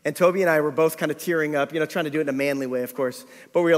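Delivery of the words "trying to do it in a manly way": ordinary talk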